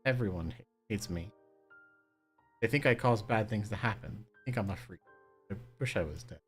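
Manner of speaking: put-on despairing girl. Kaine despairing in tears.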